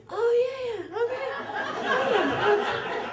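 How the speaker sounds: slow, high-pitched nervous agreement; forced enthusiasm. Retold or imitated. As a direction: pretend you love it while panicking inside. Fake enthusiasm about open-air safari.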